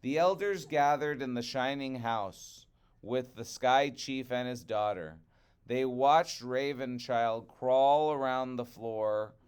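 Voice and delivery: usual speaking voice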